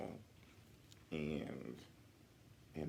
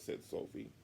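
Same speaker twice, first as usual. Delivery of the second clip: plain reading voice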